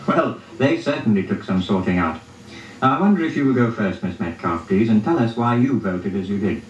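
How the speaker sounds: ordinary delivery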